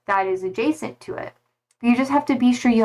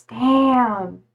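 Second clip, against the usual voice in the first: ordinary talk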